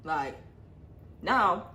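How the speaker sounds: ordinary delivery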